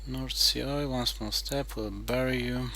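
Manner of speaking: ordinary delivery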